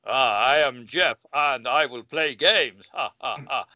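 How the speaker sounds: slow, hammy villain voice with a mock laugh; lightly put-on gloating villain, slowly; Jeff villain voice. Shown as a demonstration. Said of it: "I'm not going to use that voice".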